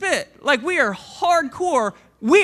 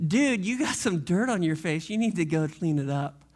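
low, laid-back dude voice, a bit softer; casual paraphrase of Jesus; 8 semitones lower, 4 dB quieter than usual. Retold or imitated. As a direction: toss it off like a buddy pointing out dirt. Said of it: "In other words".

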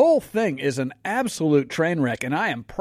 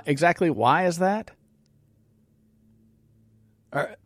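ordinary delivery

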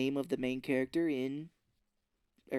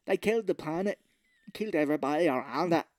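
mock accent mangled by bad teeth; trouble talking through teeth; 2 semitones higher, 4 dB louder than usual. Recapper mocking actor hampered by silver teeth, mangled speech. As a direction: talk as if your teeth get in the way. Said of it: "it's giving him problems".

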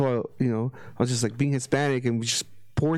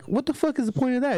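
exasperated disbelief; 10 semitones higher than usual. Real, not acted. Disbelief at shrimp left in shell.

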